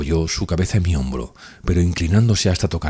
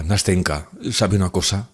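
ordinary delivery, nothing marked

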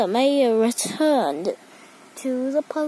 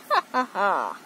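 short, low evil chuckle; low put-on bad guy laugh; villain laugh; 5 semitones lower than usual. A narrator's reading. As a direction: gloat with a low ha ha ha.

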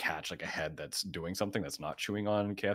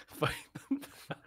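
high breathy laughing echo; laughing whisper; 6 dB quieter, faster than usual. Real, not acted. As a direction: wheeze the words through laughter.

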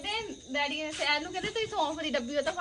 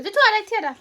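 calling out; 5 semitones higher, 8 dB louder than usual. Real, not acted. Mother calling toddler over.

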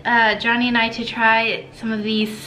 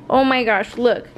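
ordinary delivery

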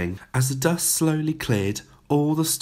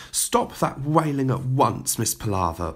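usual speaking voice

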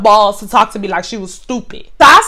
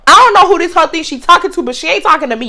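ordinary talk

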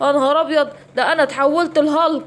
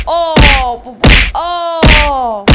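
loud cries of pain; 8 dB louder, much slower than usual. Acted. Hyenas hit in fight.

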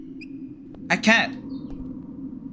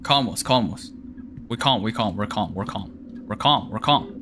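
much softer, lower, murmured calm; hushed self-soothing; 12 semitones lower, 5 dB quieter than usual. Real, not acted. Shaken player softly calming himself down. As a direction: talk yourself down quietly.

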